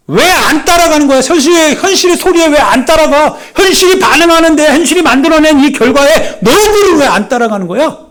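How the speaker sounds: high, loud, rising sermon cry; impassioned preacher climax; preaching at full force. Acted. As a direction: press the point hard on the congregation. Preacher voicing scoffers loudly.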